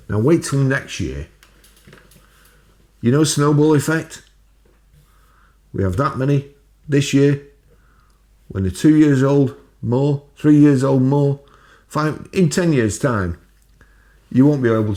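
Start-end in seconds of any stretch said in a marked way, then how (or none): 3.0-14.9 rising rant. Real, not acted. Ranting about rising numbers.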